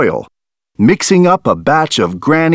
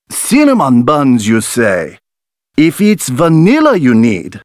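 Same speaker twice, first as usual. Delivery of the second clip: French farmer; 5 semitones higher than usual. A narrator's reading. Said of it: "twirling his mustache".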